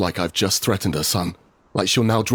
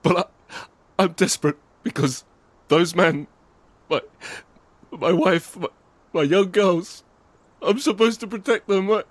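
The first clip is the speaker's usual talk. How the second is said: higher, broken desperate plea; desperate pleading; 11 semitones higher than usual. Acted. Narrator pleads with Lena for his life, desperate. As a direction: beg for your life, stumbling.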